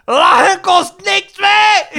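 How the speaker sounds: put-on high, loud, slow drunken shout. Imitating the drunk woman shouting at him, high and drawn out. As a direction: bellow it like a tipsy woman in the street. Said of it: "En dan zo".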